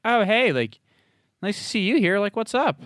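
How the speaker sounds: high, easy hey as Sean; casual flirty hello. Retold or imitated. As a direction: keep it light and lifted, just saying hi. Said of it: "it was just a very, like".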